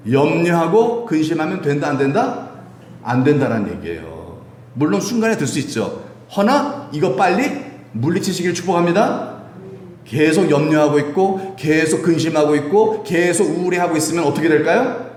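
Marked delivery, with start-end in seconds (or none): none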